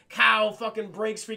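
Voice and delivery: normal talking voice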